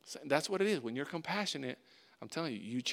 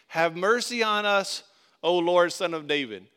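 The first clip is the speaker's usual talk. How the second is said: crying out; 6 semitones higher, 11 dB louder than usual. A narrator's reading. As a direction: cry out for mercy to someone passing. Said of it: "they cried out".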